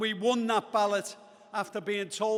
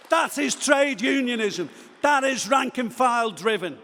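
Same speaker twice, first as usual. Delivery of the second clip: fired-up rally shout; 4 semitones higher, 6 dB louder than usual. Real, not acted. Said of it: "Get excited now and again".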